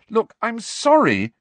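loud, high, exasperated outburst; crying out. A narrator's reading. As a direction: cry it out in protest. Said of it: "cried Hermione".

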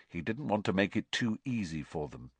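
ordinary delivery, nothing marked

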